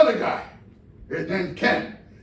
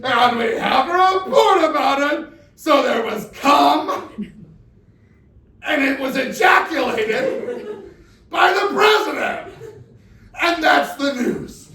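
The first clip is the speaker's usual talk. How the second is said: mock newscaster; 7 semitones higher, 7 dB louder than usual. Mocking TV news anchors.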